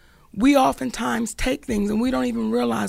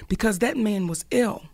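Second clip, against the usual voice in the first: ordinary talk, nothing marked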